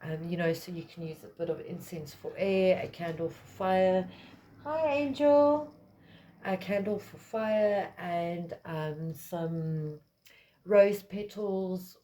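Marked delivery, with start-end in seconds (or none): 4.6-5.7 high, drawn-out hello; high-pitched greeting. Real, not acted. Breaks off the talk to greet Angel, high-pitched and drawn out. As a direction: greet them slowly in a raised, louder voice. Greets someone who just joined.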